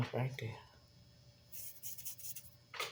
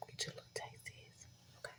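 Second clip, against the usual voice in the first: soft whisper, almost no voice; 23 dB quieter than usual. Real, not acted. Whispering to the person she hands a taste. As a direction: breathe it out quietly like a secret.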